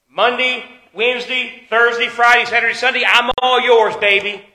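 emphatic list building to a big pledge; mock devoted pledge. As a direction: play the cheater grandly promising himself. Acts cheater grandly pledging other days.

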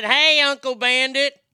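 put-on slow, high, loud quote of the kids; lightly voiced kids calling to their uncle; quoting kids. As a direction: call it out like the kids in the movie. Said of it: "When they said".

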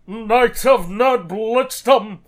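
loud, slow booming announcer; drawn-out arena announcer call. Pretend. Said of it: "my standard announcer voice".

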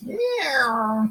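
high squeaky meow; cat meow. Pretend. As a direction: meow like a kitten. Cat bit continues.

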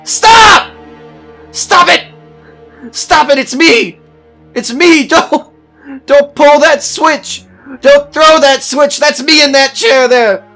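frantic, very high full-force screams; screaming. Acted. Man seeing himself in the electric chair, screaming. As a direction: scream it desperately to halt an execution. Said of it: "I jumped to my feet and I screamed".

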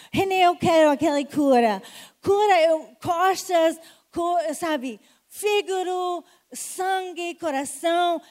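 a hint of an eager congregant, loud; loud, lightly voiced plea for a cure; voicing a congregant. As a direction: plead for healing as a churchgoer. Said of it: "voices a congregant asking healing".

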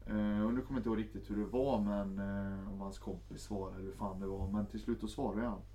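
ordinary talk, nothing marked

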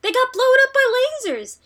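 high, loud and disbelieving. Real, not acted. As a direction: push it up in outrage. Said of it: "repeated with more force".

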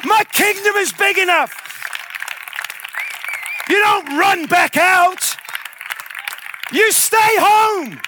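high, full-force preacher shout as Jesus; loud, impassioned rebuke; passionate shouting. Acted. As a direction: thunder each declaration like a commanding Lord. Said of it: "I know I'm shouting, sorry, 'cause I'm passionate".

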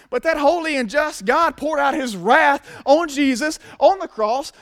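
slow, high-pitched sermon peak; full-force preaching. Real, not acted. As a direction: build to a forceful climax, leaning on key words.

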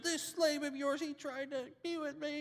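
high, put-on wife voice; complaining wife. Potiphar's wife complains to her husband, high whiny voice. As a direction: whine it to the husband. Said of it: "She tells her husband".

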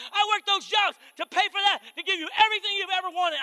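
high, loud angry rant; husband ranting. Retold or imitated. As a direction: hammer every "I pay for that" like an accusation. Husband lists what he pays for.

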